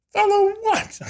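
very high-pitched, incredulous squeal; mock bewildered. Husband told to follow the swarming bees, comically bewildered. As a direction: imitate a baffled, squeaky retort. Baffled reply to wife's instruction.

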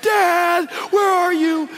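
put-on high, loud little-boy wail; stretched-out scared kid cry; imitating screaming child. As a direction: call out in a mock panic, high-pitched. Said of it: "Wyatt, is spinning around going".

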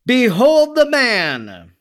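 high, drawn-out proclamation to a crowd; crying out. A narrator's reading. Pilate presenting Jesus to the crowd, crying out.

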